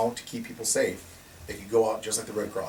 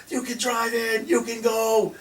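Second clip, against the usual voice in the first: much higher, excited urging; mock excited newsman; 12 semitones higher, slower than usual. Imitating the Fox News reporter, high excited urging. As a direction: play the newsman egging them on, high. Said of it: "He was yelling".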